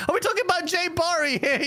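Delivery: ordinary delivery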